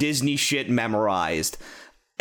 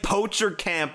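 ordinary delivery

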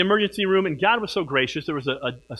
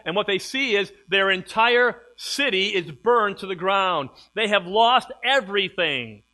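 louder storytelling sermon voice; preaching; 2 semitones higher, 5 dB louder than usual. Acted. Pastor retelling the burned city, pressing, preaching. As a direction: drive the story home to the church.

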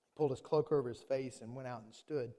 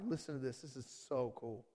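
ordinary delivery

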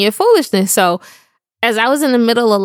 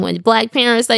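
ordinary talk, nothing marked

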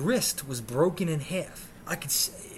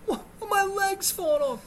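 high, crying wail; wailing protest; 3 dB louder than usual. Retold or imitated. Injured patient protesting to nurse, high wail. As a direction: wail it in disbelief and agony.

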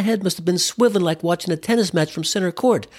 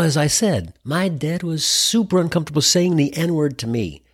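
ordinary talk, nothing marked

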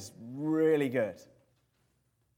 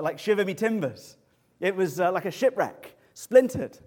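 rising louder and higher to a cry on splintered; building excitement; 5 semitones higher, 6 dB louder than usual. Acted. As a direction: swell up and burst on splintered. Dramatic shattering of the flask.

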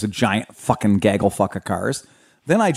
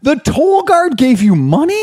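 astonished; 14 semitones higher, 4 dB louder, slower than usual. Real, not acted. Disbelief at toll guard story.

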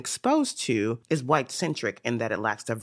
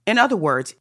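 higher and louder "In other words"; emphatic signpost; 7 semitones higher, 6 dB louder than usual. Real, not acted. Lecturer rallies the interpreters, higher and louder. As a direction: lift the phrase up high and loud. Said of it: "emphatic restatement to audience".

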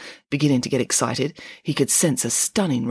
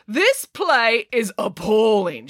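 slow, high, outraged verdict; theatrical denunciation; denouncing; 6 semitones higher, slower than usual. A narrator's reading. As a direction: condemn it with drawn-out indignation. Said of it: "she denounced".

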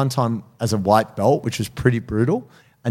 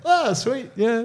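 high, blissed-out mock sigh of pleasure; kinda happy; 12 semitones higher, slower than usual. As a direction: laugh into it, silly and dazed. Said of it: "I get kinda happy".

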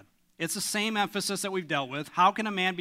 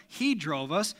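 usual speaking voice

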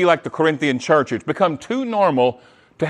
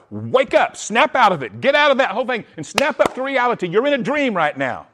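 building from a call to a fast, higher push; rising rousing urgency; 6 semitones higher than usual. Real, not acted. Preacher urging his church to wake up, rising urgency. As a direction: shake them awake, picking up speed.

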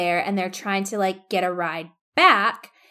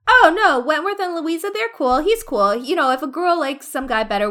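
high, airy brush-off; imitating a cheerfully oblivious man; breezy reassurance; 6 semitones higher, 4 dB louder than usual. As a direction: wave it away in a raised pitch. Admiral breezily brushing off her question.

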